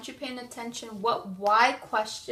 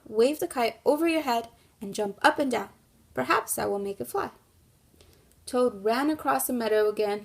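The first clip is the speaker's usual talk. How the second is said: lively storybook read-aloud for a class; reading to children; 9 dB louder than usual. Acted. Teacher reading the kite story to her class, in a lively storybook voice. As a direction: read it like story time for young kids.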